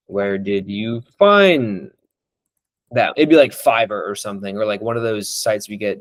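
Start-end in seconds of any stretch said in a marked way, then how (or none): 0.1-3.1 drawn out very slowly, each word weighed; slow, pointed question. Shown as a demonstration. Slowly asking a prospect where he found his callers. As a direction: ask it slowly, letting it land. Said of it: "Actually, this is good".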